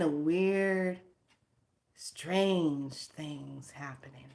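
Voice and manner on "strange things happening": slow, whispery and drawn out; eerie hush. Real, not acted. Warning viewers about a weird area, eerie hush. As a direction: let it creep out in a slow near-whisper.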